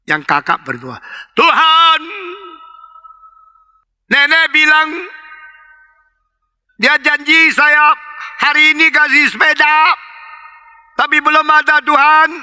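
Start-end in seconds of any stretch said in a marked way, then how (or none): 1.3-12.3 put-on shouted child's prayer. Elder child prays for the promised bicycle, shouting. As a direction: call it out so grandmother overhears. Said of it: "Dia teriak-teriak".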